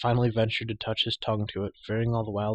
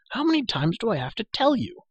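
put-on high, scolding girl's voice; exasperated; 13 semitones higher, 4 dB louder than usual. A narrator's reading. Girl to prisoner asking about her bauble, exasperated. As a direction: scold him for not listening, pitched well up. Girl tired of repeating herself.

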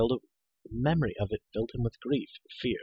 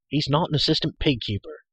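indignant; 5 semitones higher, 5 dB louder than usual. Acted. Hero indignantly defends his friend.

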